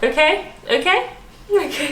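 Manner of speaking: "okay" pitched high as a half-question; questioning intonation. Shown as a demonstration. Likening the greeting to saying one word, "okay" as a mock question. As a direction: lift "okay" like a query, then laugh. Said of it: "It's not really a question".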